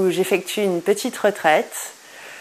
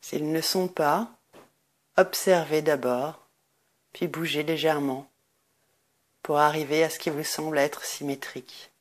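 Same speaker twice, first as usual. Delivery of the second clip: guided meditation; 4 semitones lower, 8 dB quieter than usual. Acted. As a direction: guide listeners into deep rest, lower and much softer. Leads a Nidra Yoga meditation.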